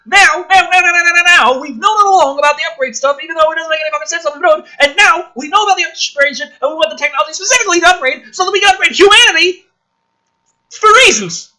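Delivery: loud, high, fast ranting pile-up; exasperated rant. Real, not acted. Commentator ranting at the film's plot, loud and high.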